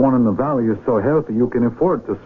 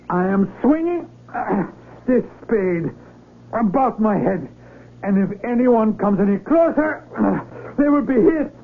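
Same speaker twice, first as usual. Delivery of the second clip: higher, strained warning cry; raised threatening shout; 9 semitones higher, slower than usual. Acted. Carlos shouting a warning to the encircling villagers. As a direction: warn the crowd off, desperate.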